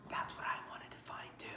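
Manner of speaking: quick soft whisper; whispered aside. Real, not acted. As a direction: confide it behind your hand. Confiding aside.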